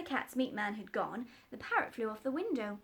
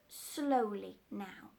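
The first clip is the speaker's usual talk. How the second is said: very slow careful request; slow, deliberate words; slowly; much slower than usual. Acted. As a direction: ask the bird to go slow. Doctor dictating.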